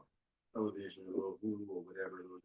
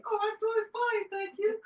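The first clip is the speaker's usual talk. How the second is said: extremely high, loud comic pet voice; imitated dog-talk; 12 dB louder than usual. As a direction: squeak it out as the dog's answer. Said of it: "talking to him like that".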